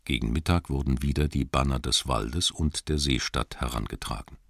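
usual speaking voice

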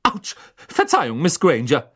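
a touch of startled embarrassment; flustered professor. A narrator's reading. Professor apologises to the student she grabbed, mildly flustered.

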